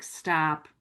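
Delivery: normal talking voice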